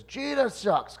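high mock taunt; retold taunt. Mets fan jeering about Jeter, high mock taunt. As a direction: jeer at the Yankees fan. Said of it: "the guy in the Mets jersey says".

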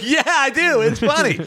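high, doting reply; dad answering his daughter high and playful. Retold or imitated. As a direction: coo back at the baby.

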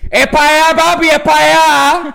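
high, loud bossy woman calling; bossy woman shouting. Retold or imitated. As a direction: order everyone that way, loud and high. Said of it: "ella quería que todos fueran". (imita a la mujer mandona gritando "es para allá")

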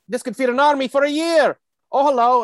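high, loud Scottish pitchman; Scottish accent. Acted. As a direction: proclaim it in a hammed-up Scottish voice. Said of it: "pretty good, uh, Scottish accent".

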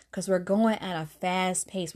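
ordinary talk, nothing marked

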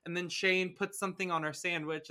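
ordinary talk, nothing marked

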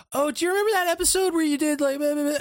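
high, fast, gushing fan voice; gushing wife voice. Retold or imitated. Wife gushing to the tattoo star about his show, high and fast. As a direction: gush like an excited superfan.

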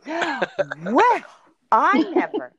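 gasping, high and loud mock shock; mock outrage. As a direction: clutch your pearls, scandalized. Mock outrage at ageist joke.

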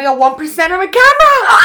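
high, loud alarmed shout. Real, not acted. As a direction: yell it in sudden panic. Camera battery nearly dead.

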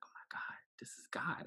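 hushed, retold astonishment; half-whispered stunned disbelief; in shock. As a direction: softly recall being awestruck. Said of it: "I was in shock".

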